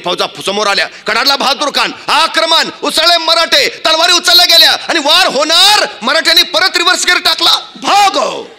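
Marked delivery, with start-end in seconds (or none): 1.1-7.5 high, driving storyteller's delivery; rousing battle narration. A narrator's reading. 7.8-8.5 high, long-drawn call to flee; mock retreat cry. Acted army fleeing after third retreat.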